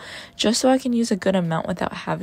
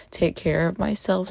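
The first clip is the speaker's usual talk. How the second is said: ordinary talk, nothing marked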